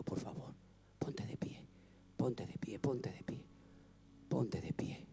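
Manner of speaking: soft unvoiced pleading, repeated; whispered plea. Real, not acted. Pastor whispering to the crowd to stand up. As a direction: whisper each call to stand.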